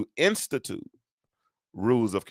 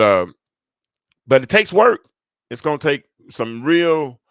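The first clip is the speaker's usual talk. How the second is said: ordinary talk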